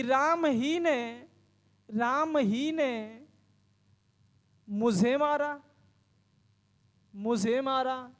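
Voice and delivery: very slow, spaced dictation with each phrase repeated. Shown as a demonstration. Teacher dictating a new example for students to copy, very slow. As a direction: dictate it word by word so students can write it. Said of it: "अलग तरीके से लिखूँ".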